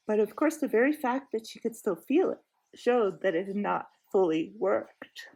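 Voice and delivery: plain reading voice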